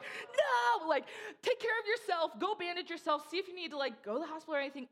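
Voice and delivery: high, loud cry easing into urgent advice; acted-out alarm. Telling bleeding roommate to bandage herself, acted-out alarm. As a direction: wail the first word, then urge in mock panic.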